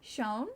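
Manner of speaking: "Schoen" said the English way, as a question; English pronunciation. Shown as a demonstration. Learner guessing at a German word, "Schoen" pronounced the English way. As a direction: try "Schoen" with English sounds, unsure. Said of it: "that's how I would pro-, pronounce it in English".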